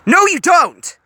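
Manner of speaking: loud, high shout; shouting back. A narrator's reading. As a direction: yell it back in her face. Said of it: "Gogo shouted it back".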